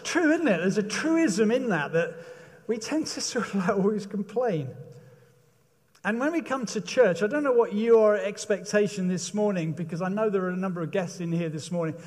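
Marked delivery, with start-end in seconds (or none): none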